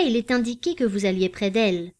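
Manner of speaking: ordinary delivery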